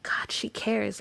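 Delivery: soft breathless whisper of awe; awed whisper. Retold or imitated. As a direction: whisper it as if it just hit you. Retelling her inner reaction.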